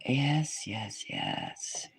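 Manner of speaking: slow, soft, low seer voice; visionary trance. Acted. A seer describing visions of the future, softly and slowly. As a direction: murmur the visions as if seeing them.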